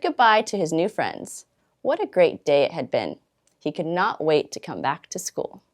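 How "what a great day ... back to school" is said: ordinary delivery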